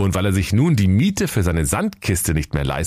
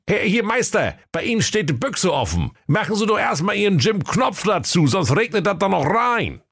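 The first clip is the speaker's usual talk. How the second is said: high-pitched grumbling sleeper-car conductor; comic high fool griping; high sulky conductor; 13 semitones higher than usual. Acted. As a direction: whine about the job in a high silly voice. Schwerthelm voice.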